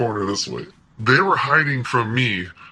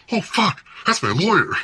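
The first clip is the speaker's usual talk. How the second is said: high-pitched burst of surprise; retold startled realization; 9 semitones higher than usual. Teller spotting his lawyer amid police, re-enacted surprise. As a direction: replay the sudden jolt of recognition. Acts out sudden recognition.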